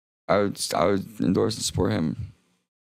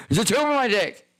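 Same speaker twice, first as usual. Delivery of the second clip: panicked yell. Retold or imitated. Waking from overdose, yelling about the catheter: frantic high yell. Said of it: "the first thing I yell when I wake up".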